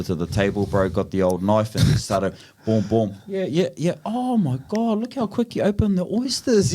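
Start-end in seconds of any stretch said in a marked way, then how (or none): 3.3-6.7 high, squealing mock auntie praise; high squealy aunties cooing. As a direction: gush over him in a high put-on voice. Aunties praising his oyster opening.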